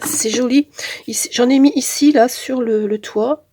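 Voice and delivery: usual speaking voice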